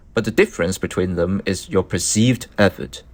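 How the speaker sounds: usual speaking voice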